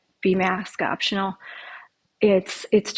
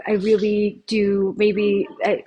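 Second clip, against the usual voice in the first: usual speaking voice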